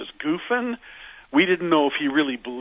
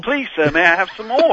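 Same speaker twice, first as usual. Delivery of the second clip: higher, louder mock British plea, Oliver style; mock British accent; 6 semitones higher, 7 dB louder than usual. Band begging Sir George Martin in mock British accent. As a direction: beg in a hammed-up British accent. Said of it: "Did you say it with a British accent?".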